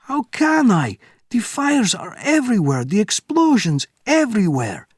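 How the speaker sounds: very high, loud despair; despairing officer. Acted. Captain protesting to Bourne, panicked. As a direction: cry out in helpless panic.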